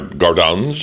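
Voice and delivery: nasalized French-style GARDENS; French accent. Pretend. Prize reader playing up a word, French accent. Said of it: "that sounds French".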